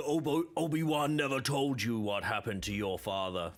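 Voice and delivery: a hint of a deep villain; mild Vader impression; lightly voiced Vader. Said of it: "he said".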